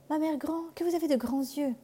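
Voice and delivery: put-on high, wondering little girl; astonished girl. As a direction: marvel like a curious child. La fillette s'étonne. (fillette étonnée, voix haute)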